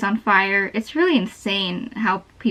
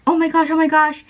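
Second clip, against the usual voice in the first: excited; 6 semitones higher than usual. Retold or imitated. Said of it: "she was all excited".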